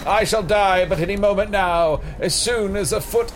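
high, theatrical deathbed wail; hammy dying hero. Acted. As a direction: ham up a melodramatic death. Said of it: "Play it up".

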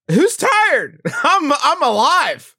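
manic excitement. Real, not acted. Insisting he's not tired, laughing.

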